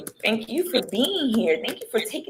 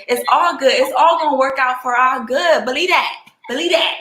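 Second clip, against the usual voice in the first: rousing affirmation; 5 semitones higher, 7 dB louder than usual. Real, not acted. Host preaching positivity to listeners.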